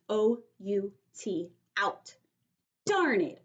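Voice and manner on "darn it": put-on higher, louder frustrated kid; Junie B. grumbling. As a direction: stamp out the words in frustration. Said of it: "I said".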